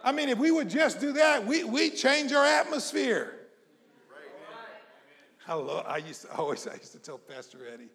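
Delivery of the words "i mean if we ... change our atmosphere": high, raised preacher's voice; rally them with loud, high exhortation; rousing preaching. Real, not acted. Preacher urging a discouraged congregation to cheer up, rousing preaching.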